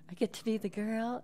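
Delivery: put-on high-pitched girl character voice. Shown as a demonstration. Narrator listing audiobook parts, high girl voice. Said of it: "you get to play all the characters".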